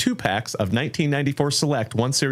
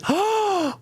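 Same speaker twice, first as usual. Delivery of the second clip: sharp falsetto gasp; high shocked gasp. Real, not acted. More gifts arrive.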